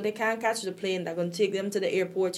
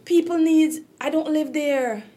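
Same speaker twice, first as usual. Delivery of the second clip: higher, pressing on through distress; really upset; 6 semitones higher, 4 dB louder than usual. Real, not acted. Guest explaining personal ties to the tragedy, upset. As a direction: push the words out, genuinely upset. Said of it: "I'm just really upset".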